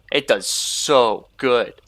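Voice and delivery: slow words through a yawn. Real, not acted. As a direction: talk while yawning. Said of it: "I don't know why I'm yawning so much".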